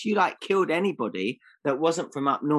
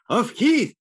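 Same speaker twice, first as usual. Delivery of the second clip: sudden high shout; loud shout; 10 semitones higher, 6 dB louder than usual. Pretend. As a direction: belt it out as a joke. Mock-obeying "say off cams" joke.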